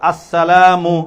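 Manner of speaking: আসসালামু slow and loud, every syllable drawn out; clear, correct pronunciation. Shown as a demonstration. Teacher models the greeting for children to repeat, slow clear pronunciation. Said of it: "স্পষ্ট শুদ্ধ উচ্চারণে কি দিব".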